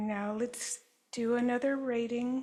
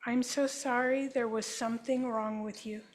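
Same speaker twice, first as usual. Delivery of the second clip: guided healing affirmation. Acted. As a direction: offer each apology gently, like a therapist. Statements for group to repeat.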